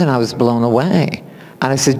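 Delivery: normal talking voice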